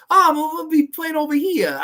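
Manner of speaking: loud, high, exaggerated New York accent. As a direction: put on a broad, hammed-up New York accent. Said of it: "she has that very New Yorker accent".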